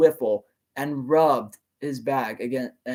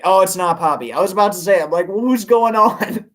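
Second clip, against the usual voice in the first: ordinary delivery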